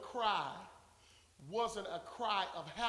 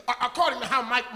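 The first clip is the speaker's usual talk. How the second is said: ordinary delivery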